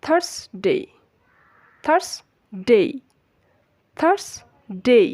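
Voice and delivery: "থার্সডে" split, "thars", pause, "dey"; model pronunciation. Shown as a demonstration. Teacher drilling learners, split-syllable model pronunciation. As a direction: separate the two syllables of "থার্সডে", glide the last. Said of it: "Repeat after me".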